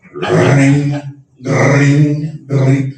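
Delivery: trilled mock telephone ring; drawn-out ringing, repeated like a phone; phone ring imitation.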